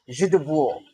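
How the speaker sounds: "Jidbo" blended as zhi-dbwo; demonstrated Polish pronunciation. Shown as a demonstration. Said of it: "That's the pronunciation".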